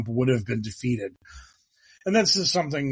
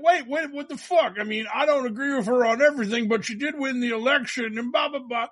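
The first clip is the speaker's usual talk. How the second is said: much higher, fast, hammed-up disbelief; mock bewildered citizen; 10 semitones higher, faster than usual. Mocking the generic American's outraged reaction, high and fast. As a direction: squeak it out like a baffled average guy. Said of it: "And you're like".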